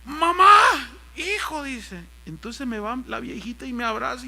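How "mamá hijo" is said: high calling out, acted; retold high-pitched exclamation; emotional reunion cry. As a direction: cry out as on spotting a lost loved one. Retold reunion of mother and son.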